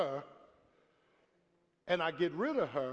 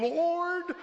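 high and loud whiny complaint; mock plea to heaven; 5 semitones higher, 4 dB louder, slower than usual. Pastor stuck behind slow shopper, hammed-up whining plea to God. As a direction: wail it up to the Lord, hammed-up.

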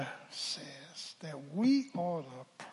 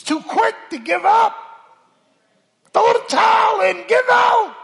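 very high, loud climactic cry; high, ringing pulpit shout; preaching whoop; 14 dB louder than usual. Real, not acted. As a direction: soar up into a sermon whoop.

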